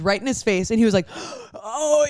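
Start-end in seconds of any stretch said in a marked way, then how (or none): 1.1-2.1 high, gasped mock-shocked "oh"; scared. Man hearing blunt sex talk, imitated with a scared high gasp. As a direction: imitate his scandalized gasp. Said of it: "he was so scar".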